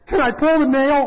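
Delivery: high, loud eager plea; hammed-up exasperated request; mock impatient pleading. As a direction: beg to fix it right now. Acting out impatient fixer.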